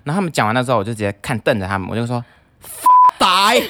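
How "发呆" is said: Danish curse yelled out, much higher and louder. Retold or imitated. Speaker yelling a Danish curse at three drunk men. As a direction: spit the swear word right at them. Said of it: "大叫这一句话".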